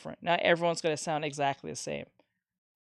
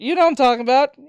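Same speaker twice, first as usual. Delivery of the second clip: excited; 7 semitones higher, 10 dB louder, faster than usual. Real, not acted. Excited recognition, joking.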